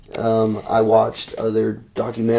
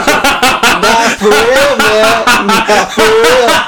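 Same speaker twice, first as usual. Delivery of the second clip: high, loud and slow through laughter; barely getting it out for laughing; real laughter, words stretched high and loud; 9 semitones higher, 7 dB louder, much slower than usual. Real, not acted. Laughing at the free candy.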